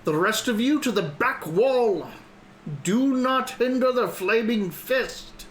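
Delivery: high, slow guard's bellow; dopey cartoon bellow. Guard captain's orders.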